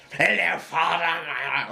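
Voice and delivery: slow, drawn-out imitation of a creepy son; lightly voiced villain, words stretched out; Barty Crouch Junior. Mocking the film's villain, slowly drawn out. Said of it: "he just goes".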